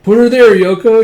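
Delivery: loud, high eager hello; excited greeting. Acted. Das greeting Yoko with excited cheer. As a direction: shout it with a grin.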